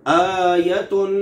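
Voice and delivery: आयतुन drawn out slowly with a light, thin ता; Arabic recitation with a thin ta. Shown as a demonstration. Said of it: "ये मैं एक हरफ पढ़ता हूँ".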